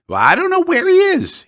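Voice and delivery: Peduzzi voice. Acted. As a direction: pitch him way up, a fussy local.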